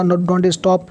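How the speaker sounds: normal talking voice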